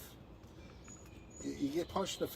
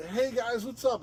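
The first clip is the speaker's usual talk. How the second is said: breezy mock greeting; 10 semitones higher, 4 dB louder than usual. Greeting the people they fought.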